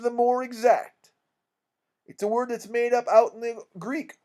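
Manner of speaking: bogus scholar holding forth, key words punched; pompous con-man King. Acted. King explaining away "orgies" to the mourners, pompous and emphatic. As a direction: lecture with unearned authority.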